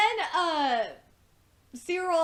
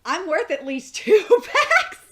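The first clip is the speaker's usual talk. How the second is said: laughing through the quote; 5 semitones higher, 9 dB louder than usual. Retold or imitated. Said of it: "Archer said".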